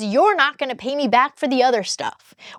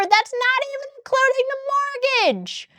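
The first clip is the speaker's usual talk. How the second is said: high exasperated squeak; 13 semitones higher, 4 dB quieter than usual. Real, not acted. Ranting about unequal bills.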